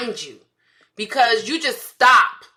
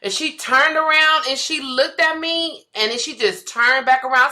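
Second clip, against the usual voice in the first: normal talking voice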